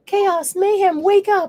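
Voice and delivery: high, loud rousing call; calling the dogs. Real, not acted.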